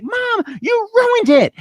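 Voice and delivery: high-pitched outburst at mom; mock teenage yell. Mortified girl turning on her mom at the slumber party, mock yelling. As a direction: wail it way up high like a mortified teen in a sketch. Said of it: "reminds me of you yelling at the gardener".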